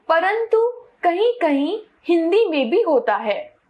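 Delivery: ordinary delivery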